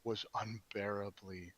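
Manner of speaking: slow, low, sinking voice; sighing dismay. Acted. Mac Reedus sighing from the floor over how bad it was, worn-out dismay. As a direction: let it drag and fade softly, worn out by it. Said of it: "Mac Reedus sighs, he says".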